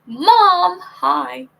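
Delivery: eager hello to mom; excited greeting. Retold or imitated.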